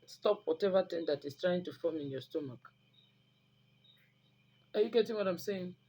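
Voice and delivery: normal talking voice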